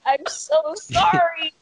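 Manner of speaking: high, drawn-out retold apology; mortified apology. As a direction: apologize in acted-out embarrassment, stretching the words. Acting out mortified apology to doctor.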